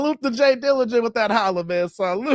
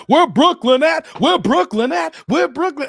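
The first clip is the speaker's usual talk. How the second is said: high, loud rapper's call-out; punchy imitation of a hype roll call; 6 semitones higher, 6 dB louder than usual. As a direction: shout it out rhythmically like a crowd chant. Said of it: "remember when [name] said".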